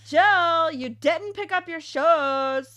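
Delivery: high, loud holler up the stairs; drawn-out yell of a parent calling a kid; imitated parent yelling. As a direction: call up to another floor, loud and high. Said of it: "They would yell upstairs from the entryway".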